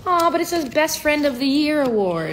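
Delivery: high, loud gushing; loud, high "aw" of delight; touched delight. Real, not acted. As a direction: gush over a friend's sweet news. Delighted at friend's card.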